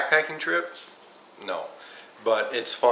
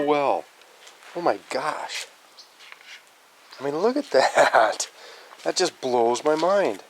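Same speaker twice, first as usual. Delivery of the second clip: amazed; 5 semitones higher than usual. Real, not acted. Amazed at the heat kept in.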